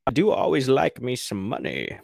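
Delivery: sly pirate voice. Retold or imitated. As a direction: savor the money like a roguish pirate. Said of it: "And he's like".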